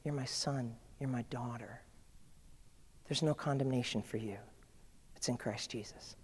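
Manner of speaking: soft, low, reassuring father voice; God softly reassuring his child; tender God. Acted. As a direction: comfort a grieving child gently. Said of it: "And God's like".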